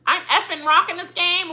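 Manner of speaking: high, mocking brag; mocking Joseph. Mocking Joseph's cocky boast, high. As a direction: parody his cocky boast. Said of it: "What did he say?".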